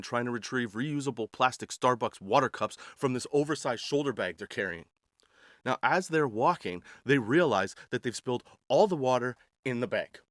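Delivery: ordinary delivery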